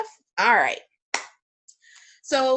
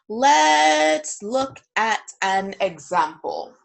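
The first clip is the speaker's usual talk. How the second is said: very slow, stretched loud opening word; drawn-out announcement; 7 dB louder, much slower than usual. Real, not acted. Teacher introducing an example to the class, drawn out. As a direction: draw it right out, louder, word by word.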